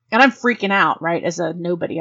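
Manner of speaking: normal talking voice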